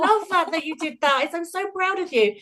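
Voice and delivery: wife praising her husband, high and tender; proud and touched. Retold or imitated. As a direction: gush over him with pride. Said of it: "And I went".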